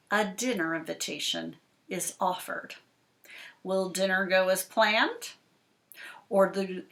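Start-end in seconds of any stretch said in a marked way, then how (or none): none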